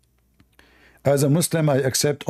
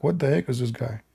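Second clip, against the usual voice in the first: calm Doberman; 2 semitones lower, 4 dB quieter than usual. Retold or imitated. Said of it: "looking at him like".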